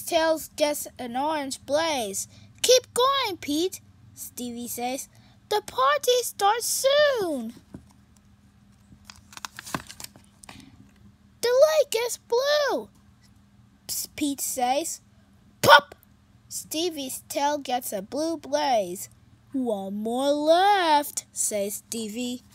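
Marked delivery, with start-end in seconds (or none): 2.6-3.8 higher, louder urging. Acted. Stevie urging Pete, excited. 5.5-12.9 higher, louder bright cat voice; cheerful kitty voice. Acted. Pete greeting his sad friend, high and cheerful. 19.5-21.3 slow, counting it out; slow and deliberate. Acted. Stevie counting the last color, slow.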